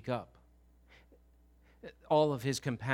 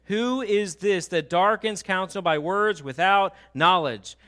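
loud, higher, measured proclamation; God challenging Job; 6 semitones higher, 10 dB louder, slower than usual. Acted. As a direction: thunder the question out of the whirlwind. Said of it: "God is speaking out of the whirlwind".

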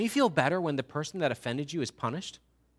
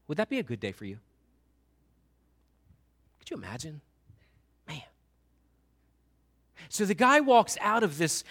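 ordinary talk, nothing marked

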